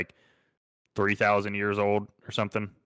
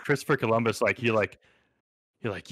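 usual speaking voice

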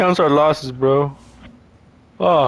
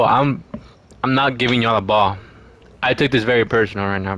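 ordinary talk, nothing marked

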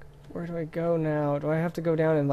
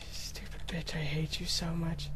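muttered curse; 10 dB quieter than usual. Real, not acted. Muttering at game.

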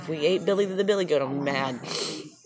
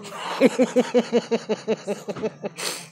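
laughing; 7 semitones higher than usual. Real, not acted. Wife laughs.

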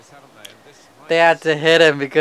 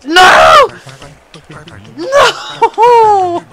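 high, loud cry of disbelief; screamed no; 13 semitones higher, 9 dB louder than usual. Real, not acted. Gamer reacting to the play, high screamed dismay.

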